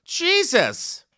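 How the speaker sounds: exasperated. Real, not acted. Exasperated exclamation.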